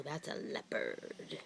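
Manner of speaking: ordinary talk, nothing marked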